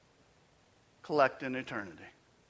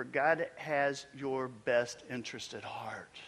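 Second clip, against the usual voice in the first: slow, unvoiced, tender; whispered reassurance; 4 semitones lower, 4 dB quieter, slower than usual. Preacher comforting the struggling listener in a whisper. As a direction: hush it like a comfort.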